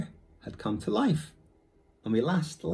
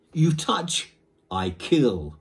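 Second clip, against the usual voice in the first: ferocious growl; 3 semitones higher, 6 dB louder, much slower than usual. Acted. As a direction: threaten the boy fiercely. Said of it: "growled ferociously".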